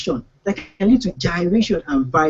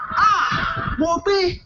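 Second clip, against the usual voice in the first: anguished wail; 9 semitones higher, much slower than usual. Retold or imitated. Finds her in bed with others.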